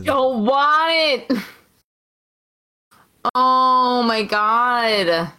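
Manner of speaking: exasperated, dragged-out moan; dismayed whining. Real, not acted. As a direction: whine it out, stretching the words. Protesting a bald Duke Hudson.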